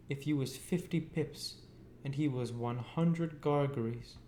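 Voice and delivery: put-on high, cross scolding of a boy; shrill-voiced sister scolding. A narrator's reading. Voices Mrs Joe scolding.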